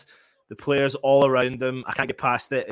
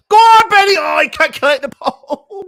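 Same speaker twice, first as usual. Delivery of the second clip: much higher and louder, yelling him on; urging cheer; 13 semitones higher, 10 dB louder than usual. Real, not acted. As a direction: shout encouragement at the screen. Urging player on, live watch-along.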